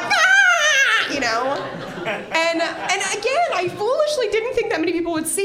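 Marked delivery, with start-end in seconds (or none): 0.0-1.1 high, loud ad-clip shriek; mock meltdown yell before a video; tantrum scream. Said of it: "you would see me being like".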